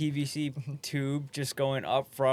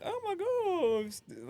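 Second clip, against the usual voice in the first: spooky ghost moan; 4 dB quieter, much slower than usual. Retold or imitated. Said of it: "It's like".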